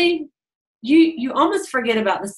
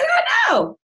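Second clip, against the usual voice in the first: very high squeal of agreement; 3 dB louder than usual. Retold or imitated. Agreeing they look cute.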